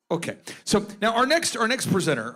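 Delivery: usual speaking voice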